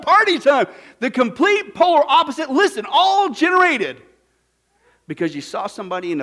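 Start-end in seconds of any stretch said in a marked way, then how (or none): 0.0-4.0 high, loud celebration; preaching at full force, elated; excited. Real, not acted. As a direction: shout the joy of the homecoming.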